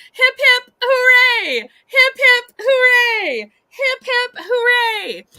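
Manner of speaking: loud high cheering chant; hooray cheer. Acted. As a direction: cheer it out like a crowd. Celebrating Gina's round win.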